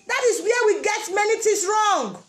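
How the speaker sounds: ordinary talk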